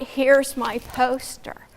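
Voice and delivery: Here's my poster drawn out slowly; slow, overdone delivery. Shown as a demonstration. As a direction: drag out Here's my poster like a bad pitch.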